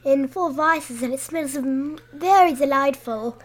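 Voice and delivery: put-on neat little British accent. Child helping dad read the ad, British accent. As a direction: list them in careful British. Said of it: "It's a pretty good little accent".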